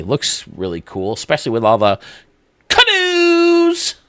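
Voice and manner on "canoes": high squealed canoes; gleeful high-pitched squeal; excited squeal. Real, not acted. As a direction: squeal it with delight. Excited about canoes in stadium.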